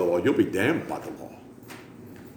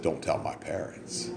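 whisper; 6 semitones lower, 12 dB quieter than usual. Retold or imitated. Congregation's secret plea.

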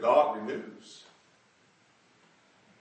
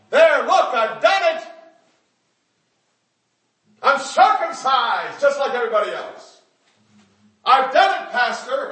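loud, high, showing off; hammed-up boast to the pastor; proud mock churchgoer; 11 semitones higher, 11 dB louder than usual. Man showing the pastor proof, loud and proud. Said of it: "I know, I'll circumcise myself".

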